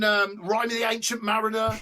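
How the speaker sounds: ordinary talk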